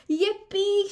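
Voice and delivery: high, loud happy cheer; excited storybook shout. A narrator's reading. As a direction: whoop it out with joy. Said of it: "shouted George".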